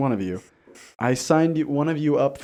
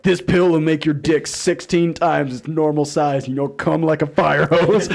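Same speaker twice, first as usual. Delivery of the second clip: spam ad voice; 4 semitones higher, 6 dB louder than usual. Retold or imitated. Male enhancement spam text, hammed-up ad voice. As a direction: hype it like a spam ad. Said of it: "I would get a text like".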